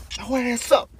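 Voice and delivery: usual speaking voice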